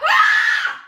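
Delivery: sudden angry shout; jump-scare yell. Pretend. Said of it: "I was not expecting anger".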